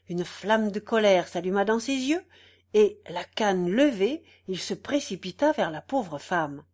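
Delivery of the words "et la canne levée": plain reading voice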